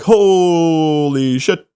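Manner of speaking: long drawn-out amazement, retold; awestruck. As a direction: stretch it slowly in awe. Retelling his amazement at wrestlers.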